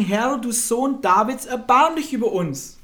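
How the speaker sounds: insistent acted cry, high and loud; noch lauteres Rufen. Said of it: "riefen nur noch mehr und sprachen". (noch dringlicher und lauter flehen)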